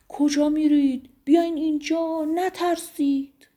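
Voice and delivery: high, loud shout after fleeing sheep; calling out. A narrator's reading. Shepherd Soleiman shouting after his frightened sheep, loud high call.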